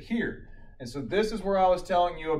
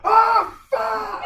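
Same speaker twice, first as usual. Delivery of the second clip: high, very loud imitated scream of pain; screamed curse; 13 dB louder than usual. Old man cardioverted while awake, screamed curse. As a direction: scream it like a man just shocked. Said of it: "just screams the F word".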